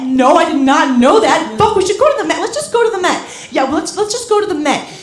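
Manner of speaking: high, loud, carried-away enthusiasm; mock over-excitement. Distracted couple deciding on the Met mid-sex, mock excitement. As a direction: blurt it out, hooked on the new idea.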